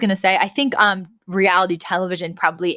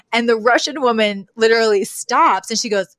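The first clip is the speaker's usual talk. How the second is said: ordinary delivery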